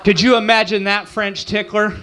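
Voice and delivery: ordinary delivery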